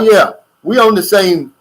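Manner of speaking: usual speaking voice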